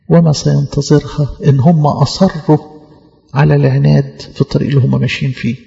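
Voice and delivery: normal talking voice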